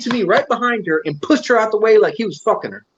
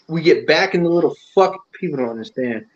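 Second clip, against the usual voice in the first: ordinary talk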